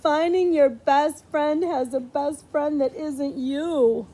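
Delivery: usual speaking voice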